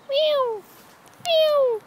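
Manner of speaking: high, loud playful cat meow; squealed meow to get attention; mock meow. Calling out imitating a cat.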